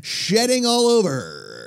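hammed-up live TV host voice; mock announcer. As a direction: present it like a ringside announcer. Mock announcer patter about own body hair.

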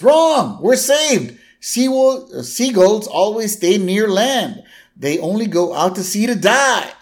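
very high, excited exclamation; Flanders elated. Retold or imitated. Castaway spotting a seagull near the raft, mock elation. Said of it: "And Flanders goes".

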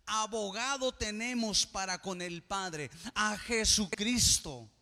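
fervent preacher build; full-force preaching. Acted. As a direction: preach it out with conviction.

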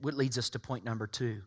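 ordinary talk

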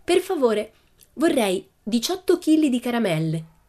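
high, lightly voiced Pippi; confident child customer. A narrator's reading. Pippi to the sweetshop assistant, confident and high. Pippi orders grandly at the counter.

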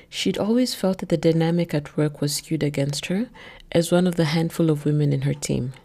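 plain reading voice

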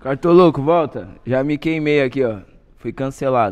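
loud call across the room. Real, not acted. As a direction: shout for him to come back. Calls out to absent host.

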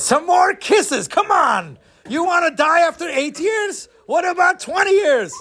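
high, shouted goading; bossy urging. Acted. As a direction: egg him on at a shout. Fake angel urges him on.

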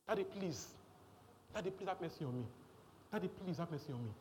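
lightly voiced child begging daddy; mock child begging. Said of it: "He's not crying".

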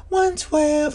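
sudden very high sung-out hook. Retold or imitated. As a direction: toss the line out way up high like the song. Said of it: "right when [name] say".

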